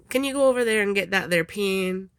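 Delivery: put-on Texas twang; bad Texas accent. Shown as a demonstration. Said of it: "that's my really bad Texas accent".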